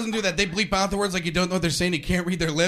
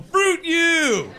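high, loud explosion; hurt outrage, hammed-up; mock angry outburst; 10 semitones higher, 7 dB louder than usual. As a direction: yell it as a comic curse. Outburst at being rejected.

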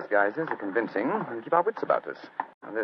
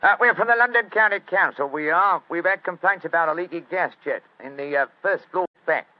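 high, loud Cockney workman; Cockney accent; 6 semitones higher, 6 dB louder than usual. Acted. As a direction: bluff Cockney, chatty and loud. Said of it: "My Cockney accent doesn't compare with yours".

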